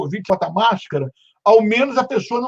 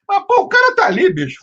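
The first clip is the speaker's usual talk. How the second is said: exasperated outburst; 11 semitones higher than usual. Retold or imitated. Exasperated retelling of the faux pas.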